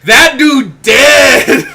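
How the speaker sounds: drawn-out loud shout of disbelief; slow shouted exclamation. Real, not acted. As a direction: yell it slowly like he's gone. Reacting to passed-out cousin.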